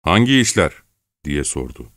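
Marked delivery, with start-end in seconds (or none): 0.0-0.7 sharp, raised-pitch challenge; hard, confrontational question; harsh voice. A narrator's reading. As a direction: demand an answer sternly. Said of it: "Çelkaş sert bir sesle".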